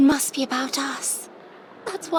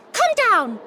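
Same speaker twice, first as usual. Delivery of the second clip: higher, louder cry at the books; shouting at books; 7 semitones higher, 5 dB louder than usual. Acted. Lavender shouting at her flying books. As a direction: order the books down with a yell. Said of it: "shouting at them".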